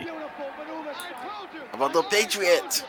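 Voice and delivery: very slow, high goofy tourist voice; mock stranger. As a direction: drag it out like a dopey tourist. Mocks stranger asking about Boston.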